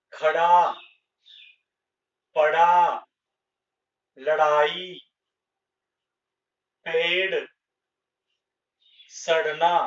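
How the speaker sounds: each word spoken separately and loud, ड़ a quick flapped retroflex r; teacher modelling the flap sound word by word; clear model reading. Shown as a demonstration. Teacher saying ड़ words for pupils to copy, crisp flap. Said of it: "इसका उच्चारण करें".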